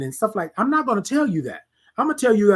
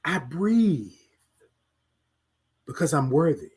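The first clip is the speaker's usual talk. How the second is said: soft affirmation; 4 semitones lower, 4 dB quieter than usual. Shown as a demonstration. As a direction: speak it softly to yourself as a promise. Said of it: "say to yourself".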